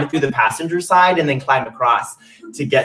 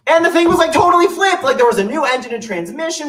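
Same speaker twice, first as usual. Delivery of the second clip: excited; 13 semitones higher, 4 dB louder than usual. Real, not acted. Excited describing the van.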